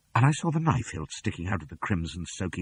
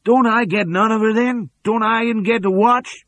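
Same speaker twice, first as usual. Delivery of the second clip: much higher, louder whine of a sulking lackey; whining henchman; 8 dB louder than usual. A narrator's reading. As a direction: plead like a greedy underling. George voiced in story.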